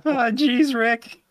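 very high squeaky cartoon kid; Morty. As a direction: whine it like a nervous cartoon sidekick. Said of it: "is a really good Morty".

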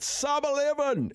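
high, slow, drawn-out mock bellow; imitating a bellowing commentator. Host mimics excited Aussie commentator calling the time, mock bellow. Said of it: "he just bellowed out".

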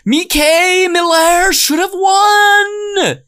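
very high, loud, slow drawn-out scream; hammed-up rage, stretched and loud; mock scream. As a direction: scream it while hurling a chair. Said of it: "the chair throwing one where he goes".

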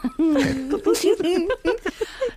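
high burst of laughter. Real, not acted. Cracking up at the line.

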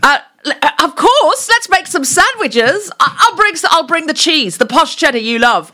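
enthusiastic. Shown as a demonstration. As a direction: accept the idea with huge excitement. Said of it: "accept each other's ideas with enthusiasm".

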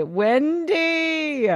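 calling Wendy high. Shown as a demonstration. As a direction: call out Wendy up high and drawn out. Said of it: "repeats the name, higher".

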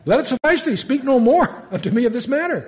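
amused mock rebuke; exasperated God. God tells Moses to drop the matter, laughing. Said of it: "but here God says".

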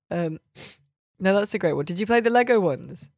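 ordinary delivery

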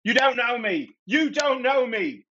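high, desperate in-character scream. Acted. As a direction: scream it high, stretching the repeat. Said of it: "and screams".

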